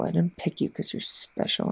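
soft breathy whisper. Acted. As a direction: drop into a whisper.

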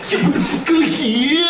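laughing. Real, not acted. Reacting to crash.

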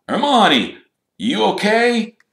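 high, loud concerned call; calling through a door. A narrator's reading. Harry checking on Hermione through the door, calling out. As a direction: raise pitch and volume to check on someone. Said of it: "said Harry through the door".

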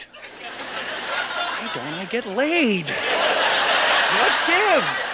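high, drawn-out comic whine; baffled teen self. Acted. Seventeen-year-old self at the mirror, baffled complaint. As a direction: plead it high, mock-exasperated.

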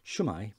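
South Welsh Shwmae, shoo-my; Welsh greeting. Shown as a demonstration. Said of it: "So South Wales, you might say".

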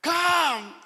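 loud, high impatient summons; re-enacted angry call. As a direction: bark it out impatiently. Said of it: "I get angry".

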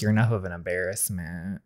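low, soft dismissive remark; dismissive put-down. Acted. Father belittles his son at the door, dismissive. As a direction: drop low and quiet to belittle.

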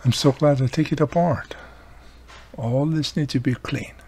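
usual speaking voice

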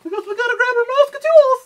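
very high, loud cartoon mouse cry; Mickey Mouse voice. Joking after a round, high loud Mickey Mouse impression. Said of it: "Mickey Mouse".

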